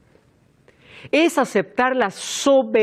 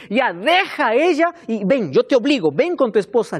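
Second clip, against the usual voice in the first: mock order, raised and insistent; forceful command; 4 semitones higher than usual. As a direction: act out compelling someone to obey. Acted God forcing a straying husband.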